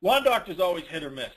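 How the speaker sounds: ordinary delivery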